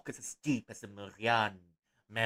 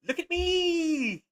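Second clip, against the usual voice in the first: high, slow squeaky meme voice; high slow mock cat voice; 5 dB louder, much slower than usual. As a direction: stretch it out like a silly cartoon cat.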